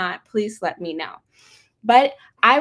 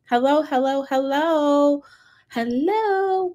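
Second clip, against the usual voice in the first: high, playful singsong hellos; calling out hello; 4 semitones higher, slower than usual. Real, not acted. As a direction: sing out a greeting to see who's there. Host calls out to online audience.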